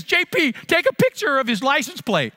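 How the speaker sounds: a hint of an urgent older woman's voice; mother-in-law's command. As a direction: hurry the son-in-law along. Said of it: "she turns to me and says".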